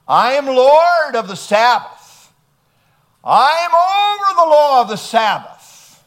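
ringing pulpit proclamation; proclaimed loud. Acted. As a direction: declare it like a royal decree. Preacher voicing Jesus's claim.

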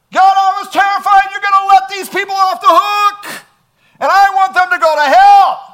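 high, loud, furious shout at God; Jonah's angry outburst. Acted. Preacher voicing Jonah raging at God over Nineveh, shouting. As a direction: hurl the confession at God in rage.

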